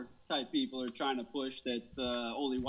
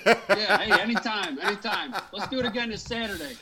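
loud laughing reply; 4 semitones higher, 7 dB louder than usual. Real, not acted. Host answering his guest's thanks, laughing loudly. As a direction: laugh it out while saying goodbye.

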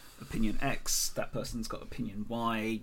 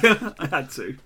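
laughing; 6 semitones higher, 12 dB louder, slower than usual. Real, not acted. Laughing at her own joke.

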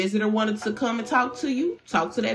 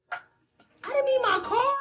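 very high, slow mock wail; whiny mock wail; slower than usual. Mimicking a client mourning her car, slow high whine. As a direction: stretch it out in a high, silly whine.

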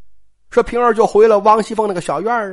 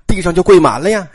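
ordinary delivery, nothing marked

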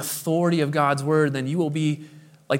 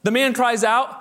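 normal talking voice

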